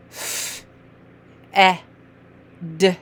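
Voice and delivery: F as long air, then eh, then short d; separate letter sounds. Shown as a demonstration. Teacher modelling sounds for a reader, said separately. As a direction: sound out F, eh, d one by one. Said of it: "making the F sound".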